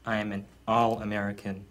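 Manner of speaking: ordinary talk, nothing marked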